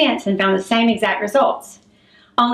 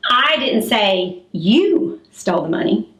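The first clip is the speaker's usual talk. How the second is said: "you" drawn out with a swooping rise, set apart by pauses; stress on you; slower than usual. Shown as a demonstration. Speaking coach showing how emphasis changes meaning, heavy stress on "you". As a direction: lean hard and slowly on the word "you". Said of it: "change the emphasis to another word".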